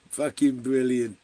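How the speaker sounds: usual speaking voice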